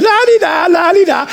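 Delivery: breeze in without a care; carefree wife. Acted.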